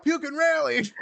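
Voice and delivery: high-pitched, hammed-up party yell; mock kid yelling. Imagined drunk preschoolers cheering each other on, mock high-pitched yell. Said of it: "kids yelling out".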